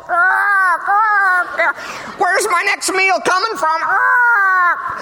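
high shrill cry of a fretting bird; panicked raven. Pretend. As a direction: wail it like a frantic worried raven. Anxious raven voiced in sermon.

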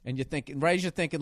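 normal talking voice

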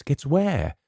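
high, happy question. A narrator's reading. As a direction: ask it lightly and happily, pitch lifted. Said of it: "she asked happily".

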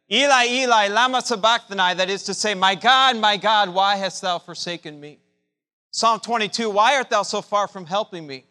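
loud, high anguished cry of the psalm. Acted. Preacher voicing Jesus' cry on the cross, loud and high.

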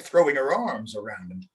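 ordinary delivery

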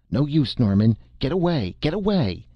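high, urgent acted cry; frenzied cry. A narrator's reading. Hackett urges Norman to flee the roof, frenzied high cry. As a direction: cry out frantically, pitch leaping up. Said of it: "he cried hoarsely, frenziedly".

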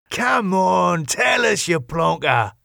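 high, jeering mate's voice; teasing badgering. Jimmy badgers Wayne to talk.

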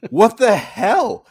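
high, loud, slow and stretched; a squawk of disbelief, way up high; outraged disbelief. Real, not acted. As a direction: drag it out in mock outrage. Exaggerated repeat of outburst.

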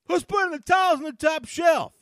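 high, loud, exasperated yell; fed-up player hollering about towels; Tariq Cohen yelling. Retold or imitated. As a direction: shout it across the locker room. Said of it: "you hear Tariq Cohen say".

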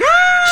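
hammed-up delighted scream; mock girly shriek. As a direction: shriek like a girl about to be proposed to. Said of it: "hands over the face, like".

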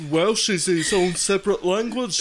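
quick, badly faked Welsh accent; crazy Welsh accent. Explaining Welsh to listeners, crazy Welsh accent. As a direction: rush along in a silly Welsh accent. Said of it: "we've got a crazy accent".

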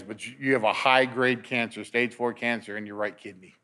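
low, soft and sober; grave diagnosis. Retold or imitated. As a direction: deliver the diagnosis quietly, as the doctor did. Doctor giving diagnosis, retold.

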